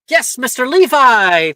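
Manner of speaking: loud, high teacher call. Acted. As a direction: call the student up brightly. Teacher calling student up.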